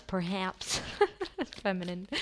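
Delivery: "perhaps" broken by a giggle; amused giggling "perhaps"; laughing. Real, not acted. Repeats perhaps, then laughs.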